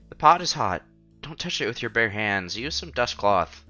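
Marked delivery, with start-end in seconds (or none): none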